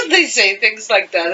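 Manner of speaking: giggling through the words; amused, chuckling as you speak; laughing. Real, not acted. Laughing at accent memory.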